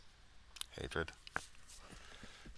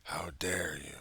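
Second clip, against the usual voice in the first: whispered scold; slower than usual. Retold or imitated.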